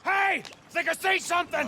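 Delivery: high, loud call to the others. Acted. Arthur alerts the gang he sees something, high loud call.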